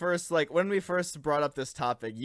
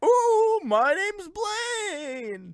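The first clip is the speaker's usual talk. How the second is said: high, very slow ghostly wail; 14 semitones higher, 3 dB louder, much slower than usual. Acted. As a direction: howl your name like a ghost. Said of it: "Did you introduce yourself in spooky ghost voice".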